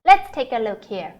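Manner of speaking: normal talking voice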